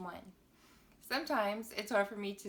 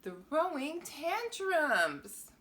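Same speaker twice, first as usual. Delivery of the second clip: drawn-out title; 7 semitones higher, 6 dB louder, much slower than usual. Acted. Reading the book title.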